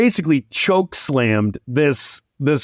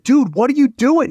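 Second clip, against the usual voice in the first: shocked angry owner; 8 semitones higher than usual. Retold or imitated. Car owner yelling at the roofer kid, mock outraged. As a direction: play the owner seeing his car dented. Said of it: "the owner is like".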